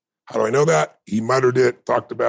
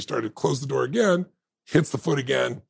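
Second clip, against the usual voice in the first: ordinary talk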